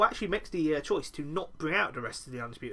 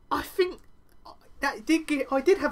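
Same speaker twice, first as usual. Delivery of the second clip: mock high; 11 semitones higher, 4 dB louder than usual. Mock Regal choice moment.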